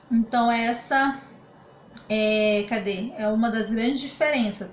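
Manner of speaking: ordinary talk, nothing marked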